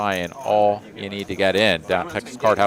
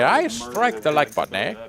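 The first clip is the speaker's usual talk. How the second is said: playful Canadian accent, higher pitch; 7 semitones higher than usual. Shown as a demonstration. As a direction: put on a Canadian accent and lean on eh. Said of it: "Get your best Canadian accent on and say".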